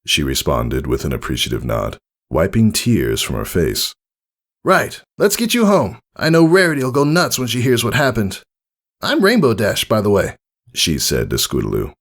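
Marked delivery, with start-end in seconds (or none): 4.6-10.4 high, brash and friendly; cocky praise from a hero; bold tomboy mare voice. Acted. Rainbow Dash praising Scootaloo after the fight, brash and friendly.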